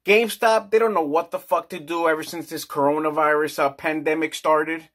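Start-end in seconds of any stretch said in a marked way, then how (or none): none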